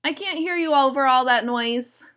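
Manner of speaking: yelled complaint; yelling. A narrator's reading. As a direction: shout as if drowned out by a din. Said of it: "yelled the muskrat".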